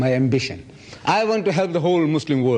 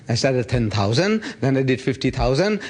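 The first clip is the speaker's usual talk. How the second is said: ordinary delivery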